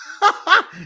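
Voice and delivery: high burst of laughter; can't hold back the laugh; a short, incredulous cackle; disbelieving laugh. Real, not acted. Laughs at the one-day suspension.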